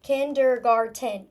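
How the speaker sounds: KINDERGARTEN stretched slowly into four distinct syllables. Shown as a demonstration. Teacher checking no syllable was forgotten, syllable by syllable. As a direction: chop KINDERGARTEN up, each syllable clear. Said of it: "when I'm stretching through it".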